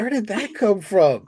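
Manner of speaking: high, startled mock question; mock shock. Podcaster voicing a shocked reaction, high mock surprise. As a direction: play it like you can't believe he said that.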